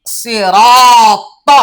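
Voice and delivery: Sirat drawn out very slowly, loud and chanted; drawn-out recitation. Shown as a demonstration. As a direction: stretch সিরাত long like Quran recitation. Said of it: "তাহলে এখানে হলো".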